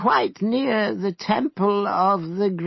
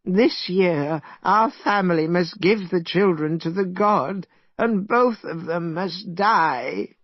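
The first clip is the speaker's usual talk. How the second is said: mild sorrow; 7 semitones higher than usual. A narrator's reading. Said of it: "he began to cry loudly".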